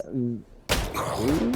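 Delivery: normal talking voice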